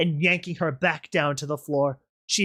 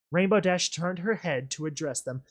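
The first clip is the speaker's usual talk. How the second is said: ordinary delivery, nothing marked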